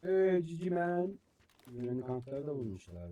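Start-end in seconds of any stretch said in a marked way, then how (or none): 0.0-1.2 slow, high, teasing mock voice; mock sweet voice. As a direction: taunt in a sing-song put-on voice. Teasing voice to enemy.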